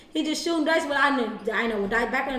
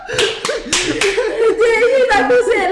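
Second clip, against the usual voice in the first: high, loud, slow, laughing; 9 semitones higher, 8 dB louder, much slower than usual. Real, not acted. Telling friends the breakup story, laughing. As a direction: get it out through laughter.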